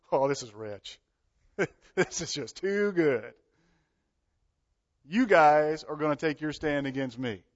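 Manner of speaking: laughing scornfully at a joke; mocking laugh. God in heaven laughing at the rebellious kings, scornful mocking laugh. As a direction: chuckle with amused contempt. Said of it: "He mocks them".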